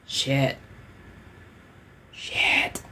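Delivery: whispered shit. Retold or imitated. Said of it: "you're like".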